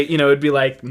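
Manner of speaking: normal talking voice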